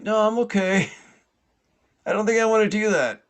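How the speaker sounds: high, loud acted holler; yelled refusal. Man in pond distrusts officer, yelling his refusal. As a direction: decline loudly across the water.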